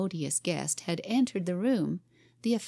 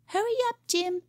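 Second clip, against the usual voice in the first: put-on much higher bossy child's call; little girl calling. A narrator's reading. Cora bossing Jim in the kitchen, high child's voice. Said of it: "cried the busy little Cora".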